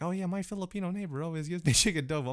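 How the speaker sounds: high, quick put-on chatter. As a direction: rattle it off in a high mimicking voice. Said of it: "that's how they talk".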